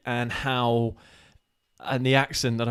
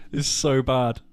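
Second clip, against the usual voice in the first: ordinary delivery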